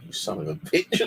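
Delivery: ordinary talk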